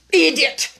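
loud, high-pitched royal scolding; angry insult. A narrator's reading. The Queen rebukes her silent Knave, loud and angry. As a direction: burst out impatiently at a fool.

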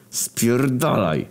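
slow, drawn-out, high snarl. Acted. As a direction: spit it out slowly in a sulk. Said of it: "warknął w odpowiedzi".

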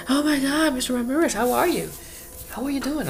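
high, gushing mock delight; gushing greeting. People on the street spotting Mister Ramirez, high gushing squeal. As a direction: squeal a delighted hello like an old friend. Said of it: "they go like".

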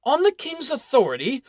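authority thrown back with a high rising scoff; incredulous mocking. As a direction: sneer the King's authority back as a question. Baron mocks the claim.

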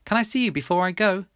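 high, urgent call; lifted-voice shouted question; calling back. A narrator's reading. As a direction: call after a departing friend. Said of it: "I shouted back to Milly".

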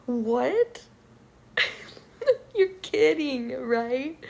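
half-laughing disbelief, voice jumping up; a stunned "you're kidding" with a laugh; amused outrage at the nurse; incredulous. Retold or imitated. Reacting to nurse's reply.

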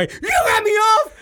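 high, loud, slow accusing shout; mock outraged shout. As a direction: yell at the kid as if betrayed. Accusing son, retold.

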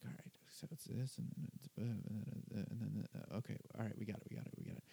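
near-whispered nervous rehearsal under his breath; muttering to himself. Retold or imitated. New host quietly going over the lineup to himself, muttering. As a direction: mumble it to yourself like a jittery host.

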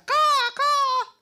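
loud, high crow cawing; crow caw imitation. Storyteller imitating the crows at dawn, loud high cawing.

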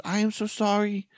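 put-on a nervous young Indian woman apologizing, way up high; Indian accent. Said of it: "She had the typical, like, Indian accent".

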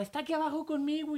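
high-pitched mock scream, no real volume; retold panicked yell. Sister telling her brother the boy is under the covers, mock yell. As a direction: imitate a frightened sister crying out. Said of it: "y me gritaba".

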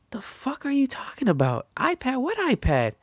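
high-pitched, incredulous "what are you talking about"; mock disbelief, voice jumping up high; baffled retort. Retelling confusion at Hillary's claim.

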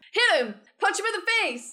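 urgent battle cry, high and loud; shouting. Acted. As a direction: yell at them to attack. Urging a fight at the door.

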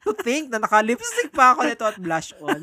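loud laughing words, high. Real, not acted. Joking about wearing lipstick, laughing. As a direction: laugh through the line.

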